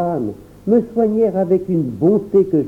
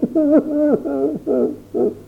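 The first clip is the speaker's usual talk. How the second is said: sanglot; 12 semitones higher than usual. A narrator's reading. Child sobbing.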